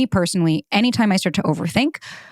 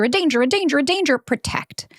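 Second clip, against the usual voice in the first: alarm-like inner voice; 8 semitones higher than usual. Retold or imitated. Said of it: "constantly alerting me of a danger".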